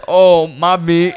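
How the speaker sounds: high, loud, very drawn-out "oops" delivery; mock sheepish apology. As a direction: retell a lame apology, dragging out each word. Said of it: "I was like".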